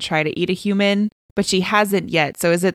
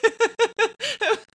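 high laughter then trailing off; real laugh fading into a breathy giggle. Real, not acted. Laughing at funny scene.

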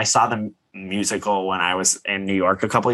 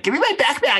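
squeaky high demand for the backpack; imitating a guest's shrill demand; high mock demand; faster than usual. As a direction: whine it like a spoiled child. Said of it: "And then she's like".

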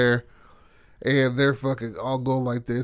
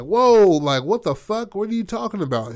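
shocked; 9 semitones higher, 7 dB louder than usual. Retold or imitated. Ben shocked at baby-eating vote.

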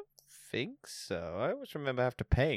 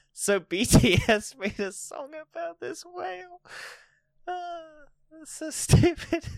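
laughing; 4 dB louder than usual. Real, not acted. Laughing at the whale song.

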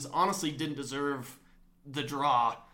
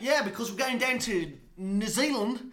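light put-on Australian-New Zealand lilt, higher; mock Kiwi accent; 7 semitones higher, 4 dB louder than usual. Hosts trading mock Australian accents, higher pitched. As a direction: pretend tourist headed down under. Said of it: "I can't do those accents".

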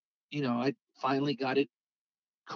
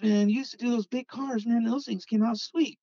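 higher-pitched buddy hyping him up; retold egging-on; 8 semitones higher, 4 dB louder than usual. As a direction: talk a friend into it, imitated.

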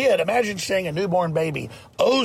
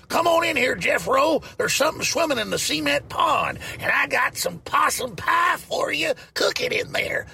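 put-on high, hollered hillbilly old woman; hammed-up hillbilly cartoon voice; Granny; 10 semitones higher than usual. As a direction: holler like a backwoods granny, pitched way up. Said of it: "She talked like Granny from the Beverly Hillbillies".

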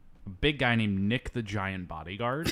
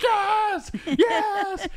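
very high, loud joyful yes; ecstatic cheering; 3 dB louder than usual. Retold or imitated. Telling friends about the movie ending, ecstatic cheer. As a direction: cheer like the game was just won. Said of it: "And I'm like".

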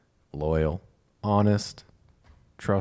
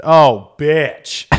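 loud, high, startled exclamation; caught-out exclamation; 7 semitones higher, 10 dB louder than usual. Retold or imitated. To therapist asking for eye contact, loud startled exclamation. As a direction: yelp it like you've been caught.

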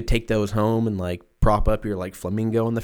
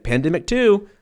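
mock announcement; 10 semitones higher, 4 dB louder than usual. Announcing sequel pandemic.

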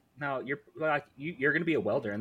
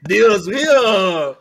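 hammed-up French accent, much higher, louder and very slow; mock French accent; 10 semitones higher, 13 dB louder, much slower than usual. Asked for Dios mío in French accent, loud mock French. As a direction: belt it out in a silly French accent. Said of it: "Can we get Dios mío in your French accent?".

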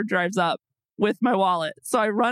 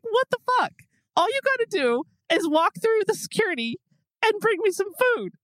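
high, indignant rant; hangry disbelief, pitched up; mock anger; 10 semitones higher than usual. Hungry wife ranting about husband at security, high indignant. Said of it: "I'm really starting to get pissed off".

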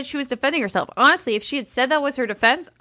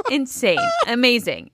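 ordinary talk, nothing marked

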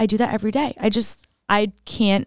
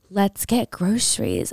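mock Kris voice; slower than usual. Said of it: "Chris is like".

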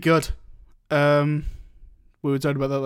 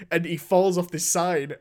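normal talking voice

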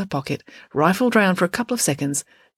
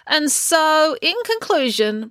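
a hint of high, pompous speechmaking; prattling mayor; 11 semitones higher, 3 dB louder, slower than usual. A narrator's reading. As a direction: prattle on in a raised pitch. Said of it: "prattled the mayor".

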